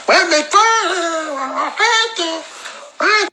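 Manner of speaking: high mocking imitation of a sulky young woman; mocking the girl's voice. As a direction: squeal it like a pouty girl mocking her. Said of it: "Vous connaissez sa bouche".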